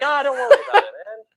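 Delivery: high, loud, laughing brush-off; mock carefree friend, high and loud; mock carefree dismissal. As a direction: toss it off like nothing matters. Mocking a carefree pizza eater.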